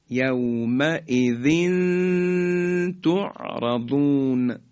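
slow recitation of «یومئذ تعرضون», hummed tanwin; measured «یومئذ تعرضون» with a long nasal link between the words; ikhfa. Shown as a demonstration. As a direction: draw out the final n of «یومئذ» into «تعرضون». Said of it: "تنوین رسید به طی دو نقطهٔ".

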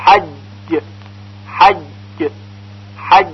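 حج with an unhurried, firm doubled جیم; slow and firm. Shown as a demonstration. Teacher modelling a measured, firm doubled جیم. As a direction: take حج slowly and firmly.

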